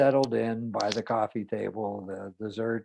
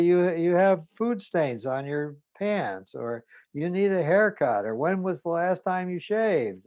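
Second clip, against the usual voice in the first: nagging mother; 6 semitones higher, 4 dB louder than usual. Retold or imitated. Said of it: "she's been scolding me".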